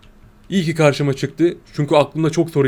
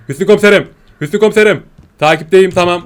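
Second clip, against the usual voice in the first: loud, higher undercover cop voice; police role play; 4 semitones higher, 8 dB louder than usual. Pretend. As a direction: report in to the chief like a cop. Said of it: "ufak bir R P yapayım dedim".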